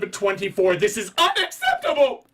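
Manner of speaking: loud, high, building to a yell; mock outraged rant. Picky moviegoer protesting his seat, mock outraged yell. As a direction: explode over a trifle. Mocking the fussy complainer.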